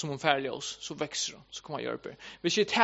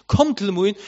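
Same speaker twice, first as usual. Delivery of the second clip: retold call, somewhat louder; raised-voice quoted summons, short of a shout; 3 semitones higher, 5 dB louder than usual. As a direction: repeat the invitation as a firm call. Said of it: "han råper".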